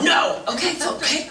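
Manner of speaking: high, emphatic mock rebuff; mock refusal. Speaker throws unwanted advice out the window, mock indignant. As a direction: shoot the advice down, high-pitched.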